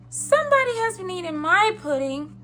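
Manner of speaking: wee little high voice; Baby Bear. A narrator's reading. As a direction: pipe up like a tiny bear cub. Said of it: "in his wee little voice".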